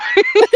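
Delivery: sudden high loud laugh; burst of laughter. Real, not acted. Amused laugh between hosts.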